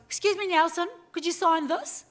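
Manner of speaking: playful mock-polite autograph plea; cheeky request.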